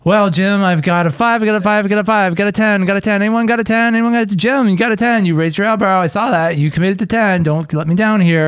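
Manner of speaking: fast-talking auctioneer chant, pitched high; high, rhythmic auction patter. Acted. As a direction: rattle off bids like an auctioneer. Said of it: "auctioneers just talk really fast".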